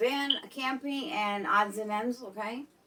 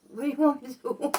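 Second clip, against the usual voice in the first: mock street tough; 4 semitones higher, 4 dB louder, faster than usual. Mock exasperated Brooklyn voice.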